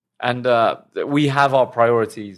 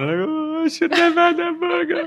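high whiny mock regret; slower than usual. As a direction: whine it, much higher. Mock regret after eating burgers.

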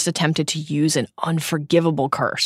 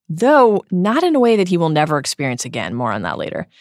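ordinary talk

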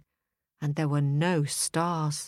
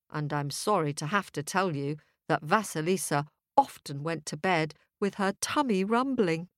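usual speaking voice

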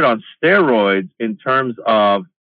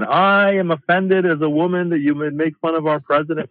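imitated drunk heckler; 5 semitones higher than usual. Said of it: "she was drunk and she kept saying".

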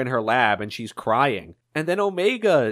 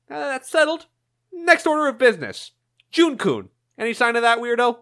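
lightly voiced Eggman; 8 semitones higher than usual.

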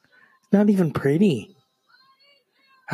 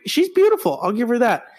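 normal talking voice

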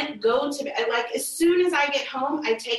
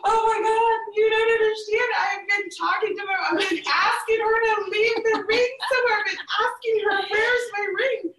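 mock tearful wail; 9 semitones higher than usual. Retold sobbing explanation.

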